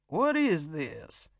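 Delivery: slow, drawn-out demand; indignant, deliberate captor; stern demand. A narrator's reading. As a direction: demand an answer, stretching each word. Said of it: "he demanded".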